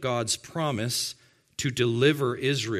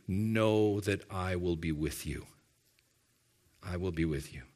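tender promise; 8 semitones lower, 7 dB quieter than usual. Acted. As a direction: hush it down like a father's vow. God's promise voiced tenderly.

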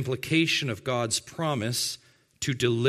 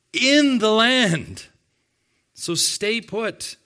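emphatic divine command; 7 semitones higher, 6 dB louder, slower than usual. Acted. Preacher voicing God's command.

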